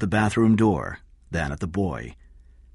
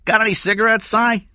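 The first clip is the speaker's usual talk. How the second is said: loud, raised call to someone in another room; 7 dB louder than usual. A narrator's reading. Eddie wants cigarettes from Cy, calling out. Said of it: "called to Cy".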